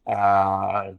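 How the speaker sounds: ordinary delivery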